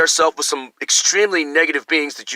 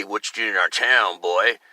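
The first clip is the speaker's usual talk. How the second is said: lower-pitched mock townsperson; 7 semitones lower, 3 dB quieter than usual. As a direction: lightly voice a local challenging an outsider. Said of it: "and now say".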